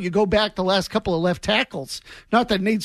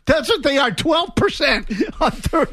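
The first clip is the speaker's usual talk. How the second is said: incredulous excitement; 7 semitones higher than usual. Real, not acted. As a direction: crow the stat in amazement, voice pitched up. Host laughing over incredible statistic.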